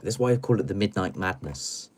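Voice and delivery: ordinary delivery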